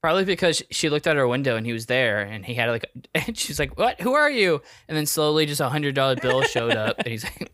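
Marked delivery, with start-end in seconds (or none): 3.8-4.6 high, loud mock alarm; startled. Streamer to man outside her window, startled. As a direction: demand it of a stranger at the window.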